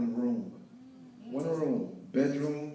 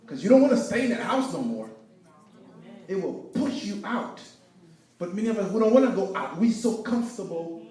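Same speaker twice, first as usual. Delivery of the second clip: higher, louder, pushing the congregation; preaching at full force; 7 semitones higher, 6 dB louder than usual. Real, not acted. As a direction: rise up and drive it home. Preaching climax.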